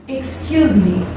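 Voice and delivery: very slow, carefully spoken English; drawn-out teacher demo of a polite phrase; slow English model for students. Shown as a demonstration. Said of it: "de quando a gente vai pedir alguma coisa em inglês".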